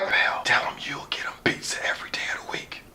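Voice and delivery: unvoiced whisper feeding lines. Introducer whispering lines to a frozen speaker, whispered. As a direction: hiss the lines low and secret like a prompter. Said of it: "He was like".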